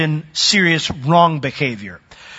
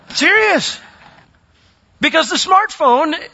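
preaching at full force; 9 semitones higher than usual. Real, not acted. Preacher urging the congregation to demolish the landing strip, impassioned and high. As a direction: lift the room, demand they smash it.

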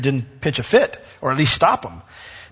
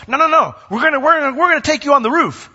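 much higher, louder, mock-eager friends; eager insistence; 11 semitones higher, 4 dB louder, faster than usual. Friends to the man on the stretcher, mock-eager insistence. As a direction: play the friends refusing to give up.

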